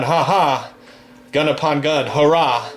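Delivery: normal talking voice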